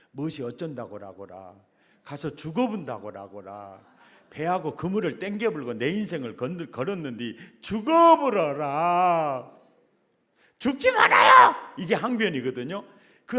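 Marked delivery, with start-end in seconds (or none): 0.1-9.5 Peter protesting in broad Jeolla dialect, building to a shout; Jeolla-accented plea that ends in a cry. Retold or imitated. Preacher voicing Peter begging Jesus not to die, in Jeolla dialect. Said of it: "이 전라도 버전으로 하면". 10.6-11.5 high, loud pleading shout; desperate cry. Retold or imitated. Peter shouting at Jesus not to die, pleading cry.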